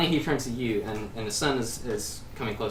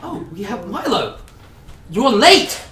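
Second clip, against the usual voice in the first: high, loud, scolding cry of a gnome; excited gnome shout; 9 semitones higher, 11 dB louder, slower than usual. Acted. Gnome scolds the late bard, high and shouting. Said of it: "And he's like".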